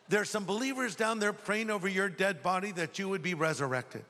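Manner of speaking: normal talking voice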